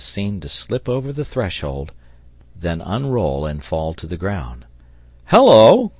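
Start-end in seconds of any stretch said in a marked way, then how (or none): 5.3-5.9 high, loud startled call; sailor's cry. A narrator's reading. Sailor seeing the ladder suddenly fall, crying out. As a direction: cry out sharply in surprise. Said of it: "cried the sailor".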